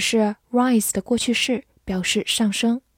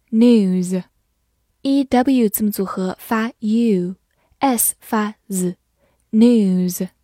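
normal talking voice

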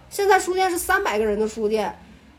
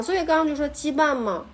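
normal talking voice

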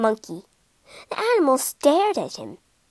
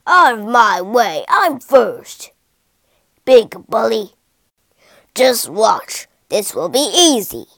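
loud, bullying elephant boast; big pushy braggart; boastful; 3 semitones lower, 6 dB louder than usual. A narrator's reading. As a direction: throw your weight around, loudly. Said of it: "he boasted".

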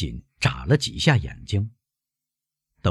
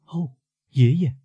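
put-on high, slightly raised girl's voice; lightly voiced loud greeting to grandfather; mild exclamation; 10 semitones higher, 3 dB louder than usual. A narrator's reading. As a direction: call out to grandfather in a higher voice. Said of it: "他大声说".

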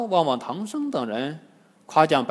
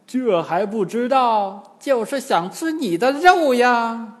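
high, loud, drawn-out gloat; 7 semitones higher, 5 dB louder than usual. A narrator's reading. Demon in old man's shape taunting monk, high gloat. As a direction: crow it slowly, rising higher. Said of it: "老翁大笑道".

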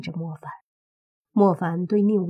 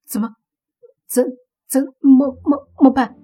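high-pitched, panicky stuttering question; acted timid creature stammering, pitched high; frightened stammer; 5 semitones higher, 8 dB louder than usual. A narrator's reading. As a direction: stutter it out, scared and helpless. Frightened hedgehog asks its companion.

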